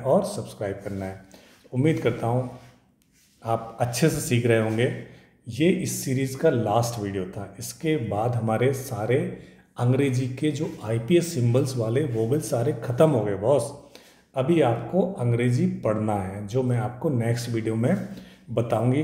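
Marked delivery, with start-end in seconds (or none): none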